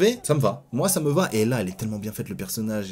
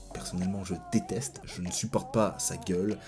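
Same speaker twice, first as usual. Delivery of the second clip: unvoiced, hushed confession; whisper; 4 semitones lower, 10 dB quieter than usual. Real, not acted. Vlogger confides he hates the actor, whispering. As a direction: whisper it like a guilty secret.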